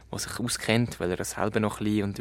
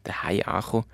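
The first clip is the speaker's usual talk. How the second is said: ordinary delivery, nothing marked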